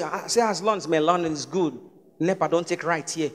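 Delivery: softly imitated British-Igbo accent. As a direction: put on a mock British accent over Igbo English. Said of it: "I saw one Igbo boy say".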